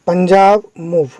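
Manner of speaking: slow, word by word; deliberate word-by-word demo reading. Shown as a demonstration. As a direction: read it out slowly for the class. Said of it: "अब देखिए मैं कैसे पढ़ रहा हूँ".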